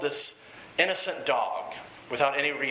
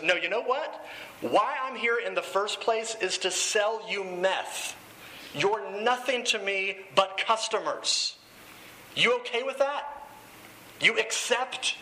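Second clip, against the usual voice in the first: higher-pitched, slow acted confrontation; retold defiant outburst, pitch climbing to the final questions; Jesse; 5 semitones higher, slower than usual. Dealer confessing to his rehab group, defiant challenge. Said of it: "Jesse launches in and he goes".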